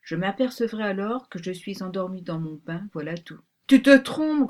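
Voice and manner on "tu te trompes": loud, raised objection with mild grumpiness; grumbling. A narrator's reading. Pink rabbit answers the girl's unspoken thoughts, mildly grumbled contradiction. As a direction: contradict sharply, louder and higher. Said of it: "grogna le lapin rose".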